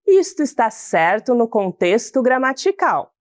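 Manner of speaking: normal talking voice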